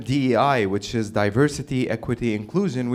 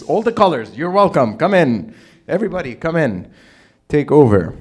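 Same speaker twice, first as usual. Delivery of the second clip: higher, louder open invitation; calling out a welcome; 3 semitones higher, 6 dB louder than usual. Retold or imitated. Old Canadians welcoming newcomers in, calling out. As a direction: beckon a crowd in, loud.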